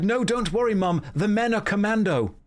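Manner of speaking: high-pitched pretend reassurance to mum; hammed-up son explaining himself; mock reassurance. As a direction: brightly reassure your mum with a silly excuse. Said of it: "It would have been better if I said".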